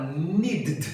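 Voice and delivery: "needed" as one syllable with a stuttered final D; impossible pronunciation. Shown as a demonstration. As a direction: drop the last vowel of "needed". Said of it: "não tem como falar". ("needed" sem vogal no final, need-d)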